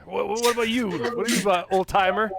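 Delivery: hearty table chat. Acted. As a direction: boom it out to the old timer. Player character chats at table.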